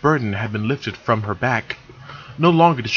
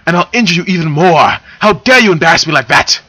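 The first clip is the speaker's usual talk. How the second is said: high, loud furious shout; angry yell; 6 semitones higher, 7 dB louder than usual. Acted. Silver yelling back at Moltar in outrage, high and loud. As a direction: threaten him at full volume. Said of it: "she yelled back".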